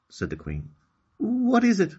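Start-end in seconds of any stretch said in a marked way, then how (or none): 1.2-1.9 put-on much higher, louder girl's question; high eager girl voice; little girl Okani. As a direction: ask eagerly. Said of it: "asked Okani".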